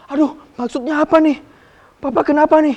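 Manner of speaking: high, anxious questions; worried son. Acted. The son confused as his father runs at him, anxious and high. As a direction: fret aloud, confused and nervous.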